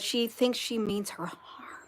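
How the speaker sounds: drops to a hushed whisper. Real, not acted. Vlogger retelling a viewer's fear, whispered. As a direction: breathe it like a secret fear.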